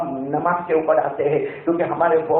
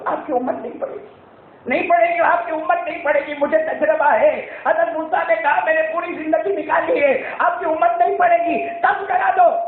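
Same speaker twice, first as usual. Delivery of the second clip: higher, rising to a shout; urgent pleading; 9 semitones higher than usual. Acted. Moses urging the Prophet to reduce prayers, rising shout. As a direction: press harder with each repeat until shouting.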